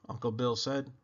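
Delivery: plain reading voice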